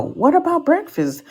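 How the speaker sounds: high put-on woman's voice asking; a light high mock of the date. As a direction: squeak it like the hopeful date. Woman's reply in retold scene.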